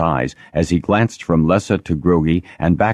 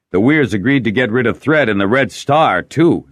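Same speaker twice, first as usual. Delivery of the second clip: raised, louder irritated tone; 8 semitones higher, 7 dB louder than usual. A narrator's reading. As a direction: snap back in annoyance. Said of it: "irritated now".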